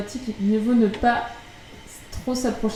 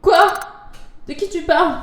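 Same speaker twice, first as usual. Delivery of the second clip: cri de surprise, très aigu; shocked; 9 semitones higher, 5 dB louder than usual. Acted. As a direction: crie d'incompréhension, paniqué. Héros sous le choc. (cri choqué et aigu)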